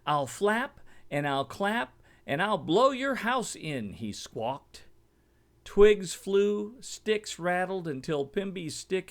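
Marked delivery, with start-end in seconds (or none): 0.1-3.9 squawking eagle. A narrator's reading. Eagle squawking threats at Mimby's grass house. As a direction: squawk the threat like a greedy bird. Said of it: "The eagle landed and screeched".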